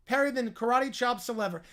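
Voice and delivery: normal talking voice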